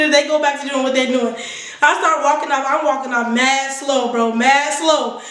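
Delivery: ordinary talk, nothing marked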